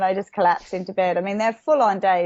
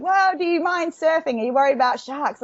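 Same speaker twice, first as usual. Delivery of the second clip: higher, mock worried questioners; worried people; 7 semitones higher than usual. People ask the stuntwoman anxiously about sharks. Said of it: "people are like".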